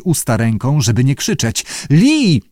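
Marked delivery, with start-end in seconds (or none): 1.9-2.4 high-pitched call of her name. A narrator's reading. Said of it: "zawołał mister Abe". (podniesione wołanie)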